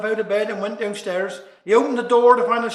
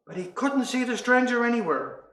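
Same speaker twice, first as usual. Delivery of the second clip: normal talking voice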